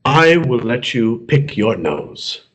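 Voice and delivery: slow, grand fatherly voice; lightly voiced solemn God. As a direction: speak as a benevolent God, slowly. God offers the Armenian his nose.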